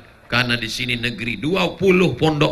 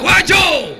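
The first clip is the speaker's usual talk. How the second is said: higher, louder, blunt answer; firm, loud reply; 7 dB louder than usual. Shown as a demonstration. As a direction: bark out the place name. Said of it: "kau harus tegas". (jawaban singkat yang tegas dan keras)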